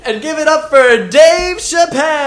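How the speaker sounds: slow, high, loud MC intro; announcer. Pretend. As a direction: hype up the comic. Mock show announcer.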